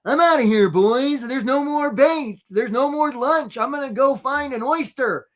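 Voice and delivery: high, drawn-out cartoon crab voice; Charlie the crab. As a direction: play a defiant little crab announcing his exit. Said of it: "Charlie talks like this".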